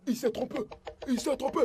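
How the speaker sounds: soft embarrassed mutter; quiet muttering. Acted. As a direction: hush it under your breath. Murmure gêné. (murmuré à voix basse)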